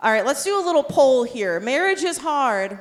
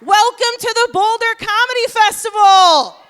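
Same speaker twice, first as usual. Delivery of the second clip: hype-up host shout; 8 semitones higher, 7 dB louder than usual. Real, not acted. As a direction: pump up the room like an MC. Host hyping the festival crowd.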